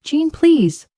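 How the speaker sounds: high, pleading wail; begging. A narrator's reading. Madison begging her sister Jean, high and desperate. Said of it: "Madison begged".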